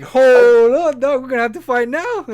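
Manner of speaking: loud, high-pitched protest; mock outrage. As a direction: shout as if insulted by a kid. Acted outrage at being called old.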